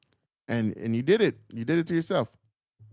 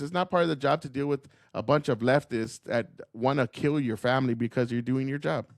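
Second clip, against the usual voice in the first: normal talking voice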